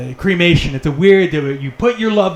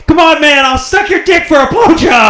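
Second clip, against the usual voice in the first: desperate crackhead; 8 semitones higher than usual. Retold or imitated. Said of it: "he's out on the streets like".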